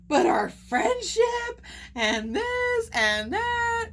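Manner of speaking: mocking the client's long pity message, whiny; mock whining. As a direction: parody her sob story, slowly. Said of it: "Same old pity potty story".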